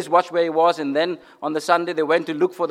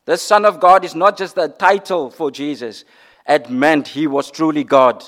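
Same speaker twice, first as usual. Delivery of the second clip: usual speaking voice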